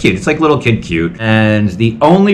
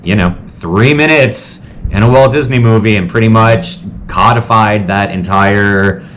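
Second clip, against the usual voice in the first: usual speaking voice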